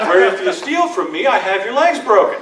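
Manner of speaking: loud, high-pitched comic ultimatum; mock threat. Lawyer answering Spain's tradition with Chicago's, loud mock threat. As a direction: belt out the threat as a punchline. Acted threat, punchline of story.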